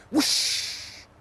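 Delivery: high breathy whooshing wind; storyteller making the gust of wind. Acted. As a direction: blow it out like a gust. Wind sound effect.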